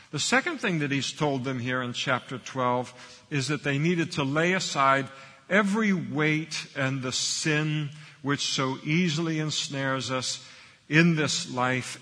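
usual speaking voice

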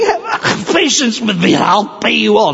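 high, strangled plea; choked pleading. Acted. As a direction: beg as if hands are round your throat. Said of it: "got his hands on his throat".

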